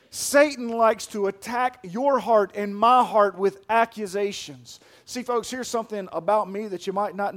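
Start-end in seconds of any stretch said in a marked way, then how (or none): 0.1-4.8 loud, driving sermon delivery; preaching at full force. Acted. Pastor urging the church to stand firm, loud preaching. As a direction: build the urgency line by line.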